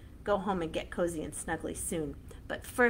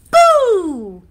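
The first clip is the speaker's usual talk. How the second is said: boo shout; 11 semitones higher, 19 dB louder than usual. Shown as a demonstration. Halloween boo game.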